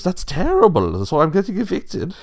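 high, loud protest; appalled rich pig. A narrator's reading. Lenny reacts to the wolf's eviction, appalled. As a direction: cry out the outrage in a high pig voice.

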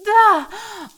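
high, loud gasping moan; sudden overwhelmed moan. Acted. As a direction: cry out on an open "ah". Overwhelmed in intimate roleplay scene.

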